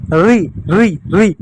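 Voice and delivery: री said crisply, one by one; teacher modelling री; sound demo. Shown as a demonstration. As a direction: clip each री clearly. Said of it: "इसको बोलते हैं री पढ़ते हैं".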